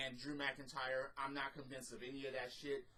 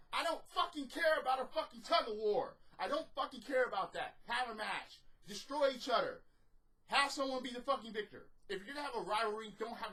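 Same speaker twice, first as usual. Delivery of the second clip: higher, louder exasperated shouting; angry rant; 8 semitones higher, 5 dB louder than usual. Real, not acted. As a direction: yell it like you're fed up with the show. Angry rant about the segment.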